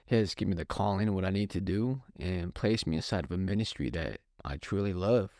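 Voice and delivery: ordinary talk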